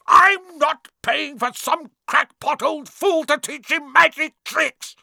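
high, loud, drawn-out angry yell. A narrator's reading. Uncle Vernon refusing to pay for magic, yelling. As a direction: cut in with an outraged shout. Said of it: "yelled Uncle Vernon".

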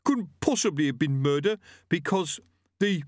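lightly voiced thug. A narrator's reading. Gripper voice.

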